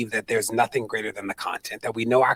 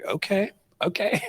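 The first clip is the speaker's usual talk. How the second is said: whispered, slow Obama; Obama impression; 7 semitones higher, slower than usual. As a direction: hush him gently like Obama. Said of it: "he, very Obama".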